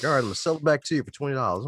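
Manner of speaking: fast, loud, high hustler voice; mock reseller. Host imitating a guy reselling the book, fast and loud. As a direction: rattle off the sales pitch. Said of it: "he'd be like".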